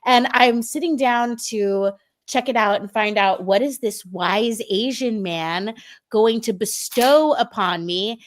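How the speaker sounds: normal talking voice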